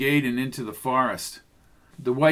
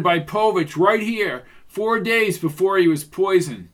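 raised, forceful accusation; insistent, mounting indictment; Strickland, confronting the suspect; 5 semitones higher, 4 dB louder than usual. Acted. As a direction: lay out the charges loud and high.

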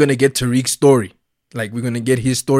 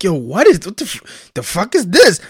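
mock outraged mom, higher; a hint of an upset mother cursing; imitating angry mom; 5 semitones higher, 3 dB louder than usual. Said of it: "She's like".

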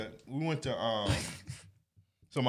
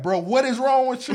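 loud, higher exasperated shout; retold yell; 12 semitones higher, 10 dB louder than usual. Big brother finding his fish boiled, shouting at brother. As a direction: yell at him in disbelief.

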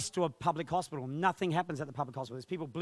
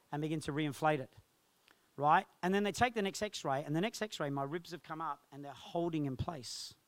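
usual speaking voice

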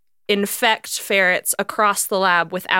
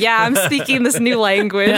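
laughing; 2 semitones higher, 5 dB louder than usual. Pretend. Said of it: "speaking the robot language".

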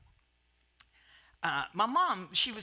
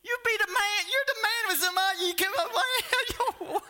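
higher, louder, laughing mock congratulation; laughing praise; 12 semitones higher, 5 dB louder than usual. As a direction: mom cheering in heaven, half laughing.

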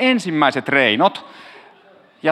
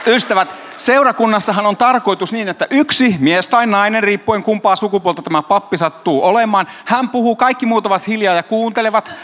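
mild mock preacher; 3 semitones higher than usual. Pastor mock-scolds listeners for chatting, in a light preacher voice. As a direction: lightly lecture the congregation like a stern pastor, tongue in cheek. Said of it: "pappi pauhaa".

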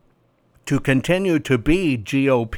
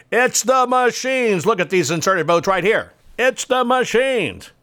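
mocking voice; 7 semitones higher than usual. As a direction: whine the excuse in a high voice. Mocking preacher's claim.

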